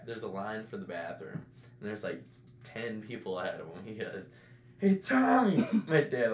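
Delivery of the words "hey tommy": high-pitched retold call to a friend; shouting across party. Dickie calls Tommy across the party, mock shouting. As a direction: imitate calling over a party crowd. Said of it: "Why are they shouting across the party?".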